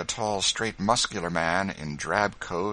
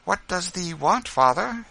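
high, louder wifely reply; raised, questioning woman's voice; Quaker wife; 13 semitones higher, 8 dB louder than usual. A narrator's reading. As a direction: answer your husband's call in a high voice. Rachel answers husband's call.

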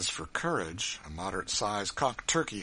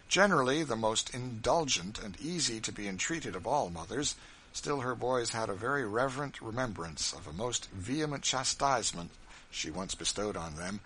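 plain reading voice

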